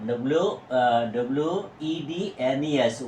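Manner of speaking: normal talking voice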